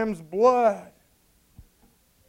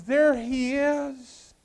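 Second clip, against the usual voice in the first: high, very drawn-out words; excited pointing out; 4 semitones higher, much slower than usual. Acted. John wanting them to see who he knew, excited call. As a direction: stretch it out high, thrilled to spot him. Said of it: "See, John was excited".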